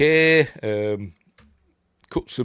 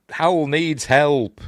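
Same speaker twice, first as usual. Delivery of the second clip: slow, higher, louder acted line; retold plea; 6 semitones higher, 6 dB louder, much slower than usual. Jessie telling Gabe about Hal, slow acted plea. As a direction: draw it out as an urgent message. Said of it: "and she tells him".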